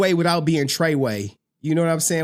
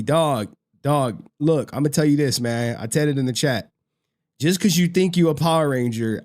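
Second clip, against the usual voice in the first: ordinary talk, nothing marked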